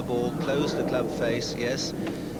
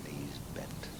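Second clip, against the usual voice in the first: whisper; 9 semitones lower, 13 dB quieter than usual. Real, not acted. Hushed golf commentary over a putt.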